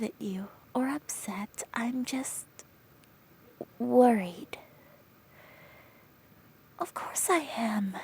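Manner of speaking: hushed comforting whisper; soothing whisper. Acted. Friend whispers comfort to the crying listener, soothing. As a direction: whisper her through the tears.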